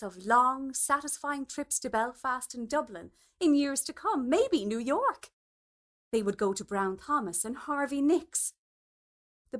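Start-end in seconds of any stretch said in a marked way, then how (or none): none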